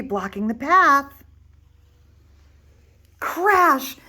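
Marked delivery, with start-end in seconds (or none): 3.2-4.0 crash and cry. Acted. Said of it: "cried Little Wolf".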